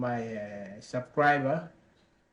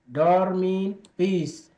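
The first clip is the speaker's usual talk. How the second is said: slow, higher and louder, word by word; slow teaching emphasis; 5 semitones higher, 5 dB louder, much slower than usual. Shown as a demonstration. Teacher glossing a Dinka word for viewers, slow and emphatic. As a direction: spell out the meaning slowly for learners. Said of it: "teacher explains a word".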